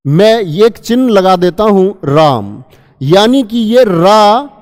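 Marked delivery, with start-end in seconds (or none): 3.8-4.5 रा held slowly, triple length; prolonged ra. Shown as a demonstration. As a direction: draw out रा for three beats. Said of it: "ये रा आपको कितनी देर में बोलना है". (रा को तीन गुना लंबा)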